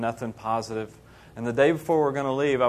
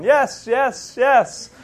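high, loud mock shouting; imitating improbable street yelling; calling out repeatedly; 10 semitones higher, 7 dB louder, much slower than usual. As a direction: shout each word separately, pitched up. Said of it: "walking around going".

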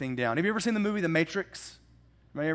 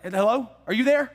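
higher, louder hello are you there; caller checking the line; 6 semitones higher, 6 dB louder than usual. Retold or imitated. Agent waiting on the phone, a raised puzzled hello. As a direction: call out into a silent phone. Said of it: "he was like".

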